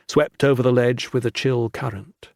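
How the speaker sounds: ordinary delivery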